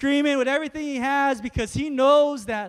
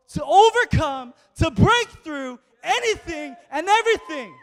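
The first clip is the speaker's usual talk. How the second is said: rising, shouting sermon build; preaching at full force; 5 semitones higher, 5 dB louder than usual. Acted. Preacher rousing the congregation about Jesus's power, in a shouting preach. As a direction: preach it till the room answers.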